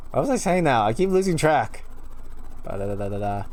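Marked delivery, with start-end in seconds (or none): none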